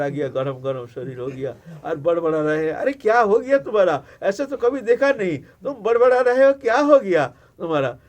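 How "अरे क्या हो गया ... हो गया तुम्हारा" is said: Parvati, higher and louder, worried; anxious wife asking her husband. Acted. Parvati worried, questioning Shiva. (चिंतित पार्वती, ऊँची और तेज़ आवाज़ में)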